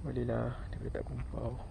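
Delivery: soft whispered commentary; real quiet whisper. Real, not acted. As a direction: hush it so the fish won't hear. Angler talking quietly while fishing.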